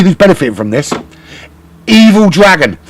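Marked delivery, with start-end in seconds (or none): 1.9-2.8 excited reveal. Real, not acted. Commentator builds to reveal.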